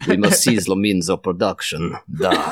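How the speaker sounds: mock-solemn Russian-accented slogan. Brother quoting revolutionary slogans to brother, Russian accent. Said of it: "I speak in a Russian accent as well".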